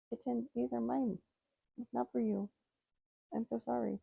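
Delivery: high, soft pet-talk voice; talking to a kitten. Real, not acted. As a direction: gently tell the kitten no, high and quiet. Gently refusing the kitten.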